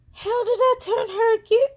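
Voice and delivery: very high, loud mock protest; indignant protest. Accused sister answering back, indignant mock protest. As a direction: squeal it back in exaggerated disbelief.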